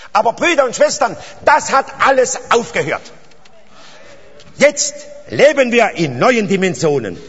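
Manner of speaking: loud, high-pitched proclamation to the congregation; fervent preaching. Real, not acted. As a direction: proclaim it with rising fervour, leaning on key words. Preaches at full force.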